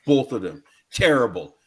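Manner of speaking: ordinary talk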